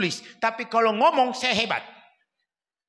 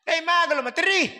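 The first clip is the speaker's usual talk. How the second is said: higher, louder scolding call; scolding husband; 7 semitones higher, 3 dB louder than usual. Retold or imitated. Husband telling his crying wife to stop, sharper. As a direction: snap at your wife to stop.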